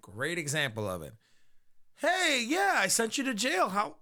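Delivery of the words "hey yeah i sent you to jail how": high, awkwardly chirpy hello; mock cheery greeting. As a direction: greet them with put-on friendliness, voice pitched up. Acted awkward greeting to jailed crook.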